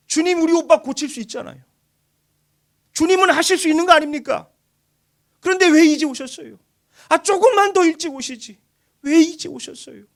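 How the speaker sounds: high, loud anguished plea; pleading with the Lord why so late, loud and high; grieving reproach. Acted. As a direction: cry out to the Lord in hurt. Preacher voices Mary's reproach.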